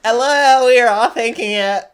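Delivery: high, loud chorus of online commenters; hammed-up high holler; mock commenter yell. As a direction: shout it gleefully like the whole comment section. Mimics the commenters.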